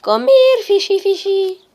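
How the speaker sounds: very high put-on squeak; playful falsetto luring; silly coaxing call. Silly calling to a fish.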